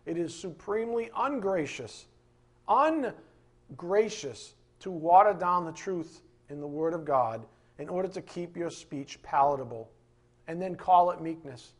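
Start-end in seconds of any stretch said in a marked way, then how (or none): none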